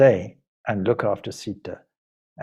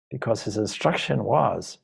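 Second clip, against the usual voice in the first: ordinary delivery, nothing marked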